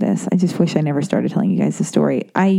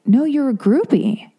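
higher, slow, smug "No, you're a groupie"; insistent mock doctor; 7 semitones higher than usual. The doctor overruling her, higher and slow. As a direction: press the verdict home.

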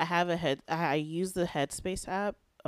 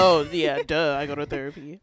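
mock duh; 10 dB louder than usual. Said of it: "I'm like".

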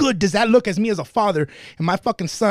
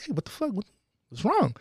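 soft, higher-pitched concern; concerned check-in; 3 semitones higher, 5 dB quieter than usual. Retold or imitated. Checking on a friend who's a little depressed, softly concerned. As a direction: gently ask a friend what's up.

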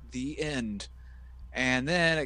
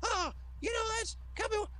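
much higher, eager pleading come on; leering King impression. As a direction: beg for more tape like a lecherous commentator. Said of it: "King was like".

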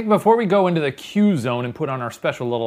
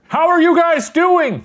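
loud, high, slow calling out; yelling; 7 dB louder, slower than usual. Real, not acted. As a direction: shout it like they're hard of hearing. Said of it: "I'm yelling like you're hard of hearing".

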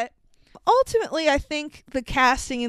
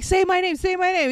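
higher-pitched insistent repeat; mock frantic demand; 7 semitones higher than usual. Narrator pleading with Marla for his name, mock urgency.

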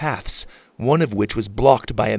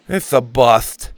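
slow, defeated sigh of a line; giving up; 6 dB louder, slower than usual. Acted. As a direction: let the hope drain out. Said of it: "giving up".

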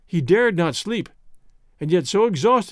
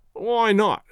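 higher-pitched defiant growl; growled retort; 4 semitones higher than usual. A narrator's reading. Starving sailor defends eating the corpse, growling. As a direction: throw the challenge back. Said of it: "growled Wilson".

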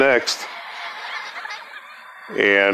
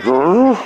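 dog growl; 7 semitones higher, 3 dB louder than usual. Retold or imitated. Great Dane woken by noise.

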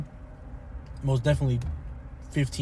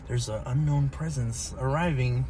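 mock ominous voice; 3 dB quieter than usual. Voices his tingling spidey senses.

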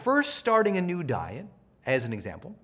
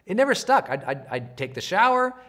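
usual speaking voice

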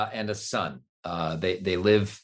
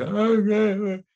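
put-on high loud kid's okay; kid with speech impediment; 9 semitones higher, 5 dB louder than usual. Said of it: "speech impediment".

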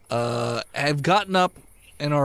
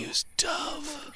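whisper; 11 semitones higher, 9 dB quieter than usual. Acted. Joking whisper interjection.

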